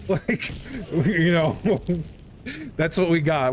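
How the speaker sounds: chuckling through the words; laughing. Real, not acted. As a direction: laugh while you mock it. Laughing at the story's weak plot.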